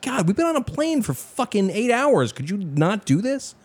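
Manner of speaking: high-pitched weary pleading; exasperated. Retold or imitated. Fed-up reaction to screaming passengers.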